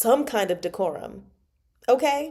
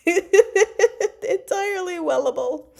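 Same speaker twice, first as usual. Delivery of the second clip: high, loud, drawn out through laughter; laughing; 10 semitones higher, 6 dB louder, slower than usual. Real, not acted. Enjoying a favourite expression, laughing hard. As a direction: giggle out the words, stretching them.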